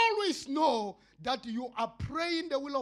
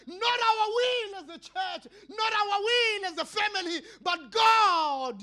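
high, loud tearful shout; shouted, crying prayer; 8 semitones higher, 7 dB louder than usual. Acted. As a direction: plead at full force. Servant's cry, shouted prayer.

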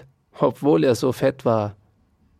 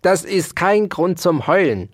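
raised-pitch irritated cry; gruff shout; 6 semitones higher, 4 dB louder than usual. A narrator's reading. Dauerspeck rebukes the weeping mouse, crying out irritably. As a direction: cut off the wailing with a raised voice. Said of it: "schrie Ambrosius Dauerspeck".

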